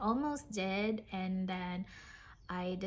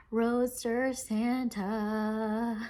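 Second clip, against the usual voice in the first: savouring delight; 4 dB louder, much slower than usual. Real, not acted. Vlogger repeating her prized kit's name, savouring slowly. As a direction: stretch the name right out.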